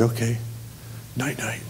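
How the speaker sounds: small, soft goodnight; hushed reply. Retold or imitated. Replying to Jesus before sleep, hushed. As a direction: answer quietly, trailing down to very soft.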